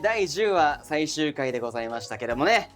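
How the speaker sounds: ordinary delivery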